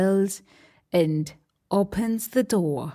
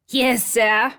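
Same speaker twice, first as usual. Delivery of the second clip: lightly voiced pirate mate; obedient Smee; 3 semitones higher, 3 dB louder than usual.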